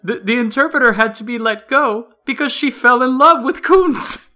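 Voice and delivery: high, loud, gleeful delivery; excited punchline. Real, not acted. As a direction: build to the punchline with delight.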